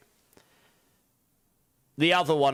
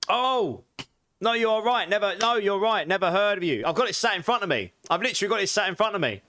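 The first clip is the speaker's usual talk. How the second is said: excited; 6 semitones higher than usual. Real, not acted. Excited indignant reaction to chat.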